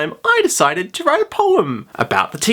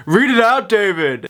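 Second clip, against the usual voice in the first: classmate calling out; 7 semitones higher than usual. Retold or imitated. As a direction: shout it out to tease. Classmate calling across the room.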